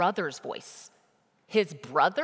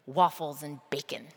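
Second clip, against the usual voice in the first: normal talking voice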